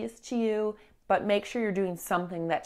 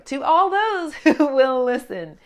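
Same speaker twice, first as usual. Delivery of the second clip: laughing through the words, louder; amused at herself, louder; 6 semitones higher, 9 dB louder than usual. Real, not acted. Laughs at her own exaggeration.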